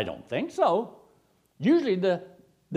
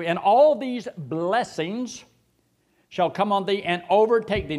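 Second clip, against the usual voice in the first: preaching; 4 semitones higher, 4 dB louder than usual. Acted. Slowed reading of the verse.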